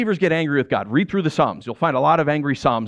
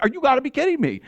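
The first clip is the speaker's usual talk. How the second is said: retold angry outburst; 9 semitones higher, faster than usual. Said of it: "I was so angry".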